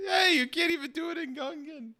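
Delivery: high-pitched mock outrage; very high, indignant complaint; exasperated protest. Real, not acted. Host protests another failed accent.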